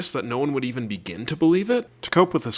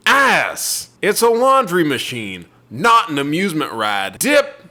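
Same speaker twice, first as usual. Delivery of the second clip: put-on southern accent; 2 semitones higher, 4 dB louder, slower than usual. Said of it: "I'm gonna be reading it in a southern accent".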